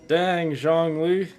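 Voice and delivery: ordinary talk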